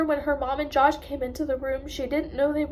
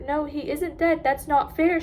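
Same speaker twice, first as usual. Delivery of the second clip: crying; 2 semitones higher, 5 dB louder than usual. A narrator's reading. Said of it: "she cried".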